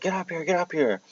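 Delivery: high, urgent mock yell without real volume. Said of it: "he's yelling".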